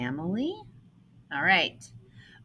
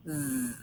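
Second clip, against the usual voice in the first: a long soft buzzing zzz sound; 6 semitones lower, 7 dB quieter than usual. Shown as a demonstration. Teacher demonstrating the voiced S to pupils, soft buzz. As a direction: hum out a steady zzz. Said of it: "You should feel the vibrations".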